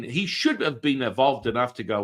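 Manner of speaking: ordinary delivery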